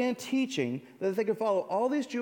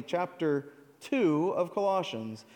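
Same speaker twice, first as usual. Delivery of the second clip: ordinary talk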